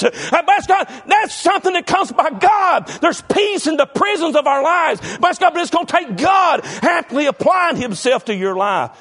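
loud, rising sermon delivery; full-force preaching. Acted. Preacher stirring the congregation, preaching at full force. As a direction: preach it hard to the church.

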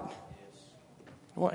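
breathy stage whisper, drawn out slowly. Acted. Preacher whispering a question to the congregation. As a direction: lean in and whisper it to the church.

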